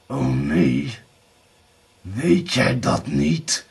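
slow, drawn-out menace; seething. A narrator's reading. Blauwbaard challenges Erika's denial, seething slowly. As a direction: stretch the mocking question angrily. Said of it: "brieste Blauwbaard".

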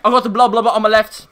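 high, loud mock accent; playful shouted accent imitation; impersonating Bo Squid's accent. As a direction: babble a game call-out in an exaggerated accent. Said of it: "We don't make fun of your accent".

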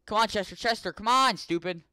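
high, loud urging of the pet; desperate high shouts; frantic yelling. Real, not acted. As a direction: yell at the pet to hurry. Yells at his pet Chester.

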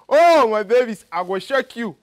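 ordinary delivery

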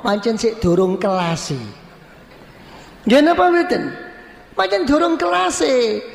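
ordinary delivery

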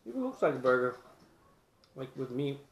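speaking between bites; talking while chewing. Real, not acted. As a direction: mouth partly full of food. Seen 0: bites piece at -1, then holds pieces near mouth, likely chewing.

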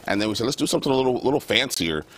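ordinary talk

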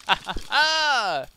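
slow, loud "ha ha ha"; loud drawn-out gloating laugh; triumphant laugh. Real, not acted. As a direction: let out a high victorious laugh. Triumph in the game.

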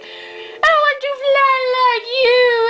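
very high, slow mock-sob; whiny crying voice. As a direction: wail it like a big baby, far up in pitch. Sad coat hanger Ali speaks.

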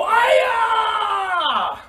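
high, very loud karate yell. Shown as a demonstration. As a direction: give the loudest kiai you can. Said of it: "you guys can be louder than that".

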